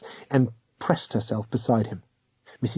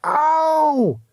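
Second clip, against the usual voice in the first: yelp of pain. A narrator's reading. Second cry of pain.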